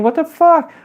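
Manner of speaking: high, panicked yelp of a frightened civilian. Retold or imitated. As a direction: shriek it like someone caught in gunfire. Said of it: "the civilian is running around screaming".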